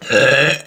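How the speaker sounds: a deep belch; long burp. Real, not acted. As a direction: let out a big burp. Burping contest with dad.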